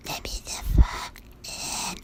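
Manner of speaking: slow, far-off broken voice; a thing mimicking speech, stiff and wrong; creature voice. The thing outside the door begging to be let in, in a slow broken inhuman voice. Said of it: "it just keeps saying".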